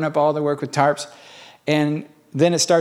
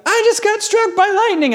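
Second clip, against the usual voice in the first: much higher, stunned and a bit louder; shocked disbelief; 4 dB louder than usual. Retold or imitated. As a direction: blurt it out, still reeling from the strike. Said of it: "So then he's like".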